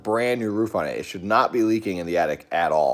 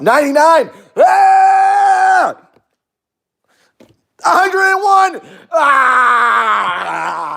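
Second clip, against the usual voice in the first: very high, loud shrieks of hammed-up horror; screaming mock panic; 13 dB louder, much slower than usual. Streamer watching likes pass a hundred, shrieking in mock panic. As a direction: shriek the count, then let out long screams. Screams as attic goal passes.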